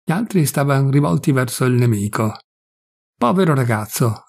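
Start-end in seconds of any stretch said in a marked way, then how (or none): none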